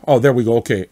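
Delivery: ordinary talk, nothing marked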